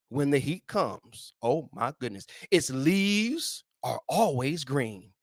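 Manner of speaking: unhurried reverent reading of the verse; slow scripture reading. Acted. Preacher reading the verse to listeners, slow and reverent. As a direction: read it slow and savour it.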